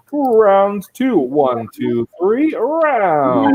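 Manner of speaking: rallying countdown. Real, not acted. Host cueing viewers at home to chant round two, rallying call. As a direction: count everyone in, stretching out "round". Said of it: "So on the count of three".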